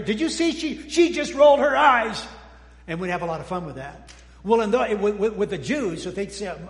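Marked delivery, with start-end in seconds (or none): 0.0-2.3 high, loud tattling outcry; mock outraged staff. Staff calling out a colleague's eye-roll, mock outrage. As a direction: exclaim it as a scandalized onlooker, pitched up. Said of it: "everybody go".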